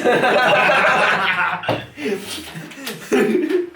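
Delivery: high burst of laughter; loud laugh. Real, not acted. (громкий смех)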